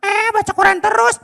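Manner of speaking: put-on high boy voice calling out, confused; child calling father. Boy Abdi calling his father, high and puzzled. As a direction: shout for dad like a lost little boy.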